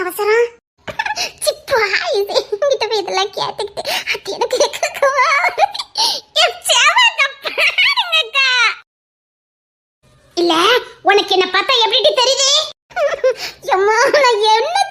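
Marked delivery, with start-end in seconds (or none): 6.4-8.5 slow, high half-laughing fake cry; mock wailing. Girl wailing to her sister about the teasing, fake crying. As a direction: ham up a tearful complaint.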